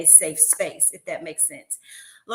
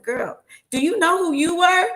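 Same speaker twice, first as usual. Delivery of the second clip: sassy retort; 9 semitones higher, 7 dB louder than usual. Retold or imitated. Teasing retort to former student.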